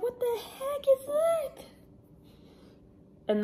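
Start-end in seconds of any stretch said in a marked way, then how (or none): 0.0-1.6 very high, slow squeal of alarm; re-enacted alarm. Vlogger replaying the spider crawling on her, alarmed squeal. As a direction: squeal it, acting out the creepy discovery.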